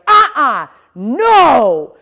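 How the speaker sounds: loud, sharp "Uh-uh, no!"; stern no. Shown as a demonstration. Trainer stopping the dog stealing the turkey, loud. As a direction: put strong pressure in the voice. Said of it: "a lot of pressure from my voice".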